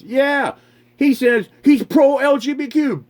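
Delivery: ordinary talk, nothing marked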